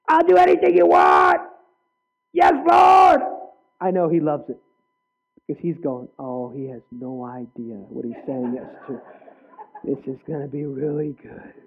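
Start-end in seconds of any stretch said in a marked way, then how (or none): 0.1-3.2 high, full-volume shouted vow; hammed-up zealous yell, pitched way up; eager shout. Acting out an eager worshipper's vow. 6.2-11.5 low, hushed amused aside; quiet, low-pitched playful relish; knowing aside. Acted. Voices God amused at the vow.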